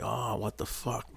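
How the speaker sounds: slow, hushed, high gasp of pain; pained shock. Retold or imitated. Guard with a popped shoulder, pained hushed shock. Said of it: "I'm like".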